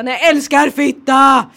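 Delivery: loud, drawn-out proclamation; defiant shout. Pretend. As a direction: declare it loudly and slowly for shock value. Rehearsing outrageous reply to old lady.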